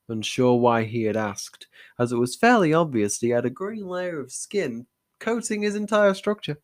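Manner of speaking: ordinary delivery, nothing marked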